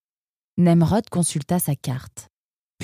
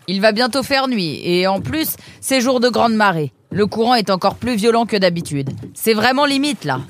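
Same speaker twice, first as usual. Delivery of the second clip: a gruff ferryman scolding, raised pitch; lightly voiced boatman; 6 semitones higher, 4 dB louder than usual. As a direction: tell the latecomer off, half friendly. Boatman explains the tide.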